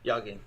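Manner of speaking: "Yogging" said with Y replacing J; soft J. Shown as a demonstration. Co-host echoing the joke, soft-J mispronunciation. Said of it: "it's a soft J".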